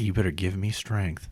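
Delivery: slow, low, very soft plea; hushed, drawn-out appeal; mock prayer. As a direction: pray under your breath for patience.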